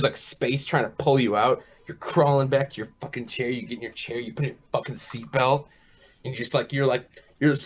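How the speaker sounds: ordinary talk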